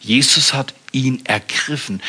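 ordinary delivery

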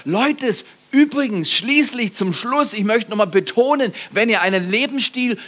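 rising preacher voice. Acted. Preaching at full force.

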